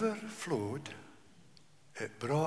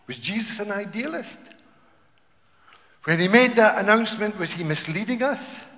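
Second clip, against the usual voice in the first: loud, fast rousing question; preacher challenging the congregation; fervent preaching; 5 semitones higher, 9 dB louder than usual. Real, not acted. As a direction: push the challenge home.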